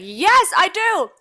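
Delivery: high, slow excited squeal. Retold or imitated. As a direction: squeal it with delight. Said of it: "And Butter's like".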